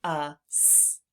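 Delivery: ordinary talk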